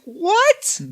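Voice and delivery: high, squeaked disbelieving What; shocked what. Retold or imitated. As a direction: yelp it out in shock. Shocked reply to crude message.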